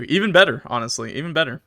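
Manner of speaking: normal talking voice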